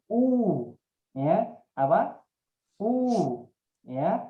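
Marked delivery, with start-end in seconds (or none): 0.1-0.7 "U" said with lips pushed well forward and rounded; lips pursed forward. Shown as a demonstration. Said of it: "dimonyongkan ke depan". 2.8-3.5 "U" said with lips pushed well forward and rounded; lips pursed forward. Shown as a demonstration. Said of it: "dimonyongkan ke depan".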